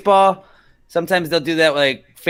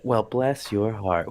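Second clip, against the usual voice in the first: polite put-down; 2 semitones lower, 6 dB quieter, slower than usual. Retold or imitated. Southerner hiding an insult behind manners, polite and slow. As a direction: drag it out politely while meaning the insult. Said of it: "I'm gonna be polite, but fuck you".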